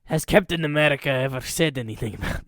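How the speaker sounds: put-on weak, half-hearted Russian accent; a bad Russian accent, barely there. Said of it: "my Russian accent is so bad".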